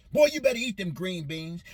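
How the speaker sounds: lightly voiced scolding mama at the table; stern mother. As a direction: warn the boy to eat his greens. Mother forcing him to eat.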